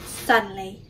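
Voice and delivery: ordinary delivery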